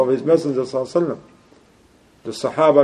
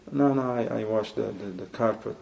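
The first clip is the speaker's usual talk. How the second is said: low, much softer, embarrassed answer; sheepish mumble; 4 semitones lower, 12 dB quieter than usual. Retold or imitated. Newcomer mumbles his humble job to the nosy brother, sheepish and quiet. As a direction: admit it shyly, trailing off. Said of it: "If you say".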